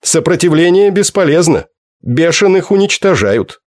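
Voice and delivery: flat and final, like a machine; alien machine voice. A narrator's reading. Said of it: "произнёс словарь".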